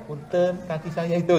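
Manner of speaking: lightly voiced gentle West Javanese man excusing himself; polite Sundanese apology. West Javanese man whose foot is stepped on, softly polite. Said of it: "Orang Jawa Barat nginjek kakinya".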